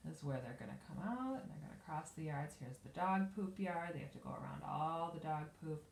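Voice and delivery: usual speaking voice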